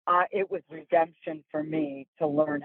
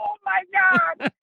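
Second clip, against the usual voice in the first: amused dismay; slower than usual. Retold or imitated. Exasperated exclaim.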